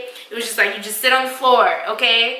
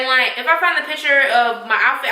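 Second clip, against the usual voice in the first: ordinary talk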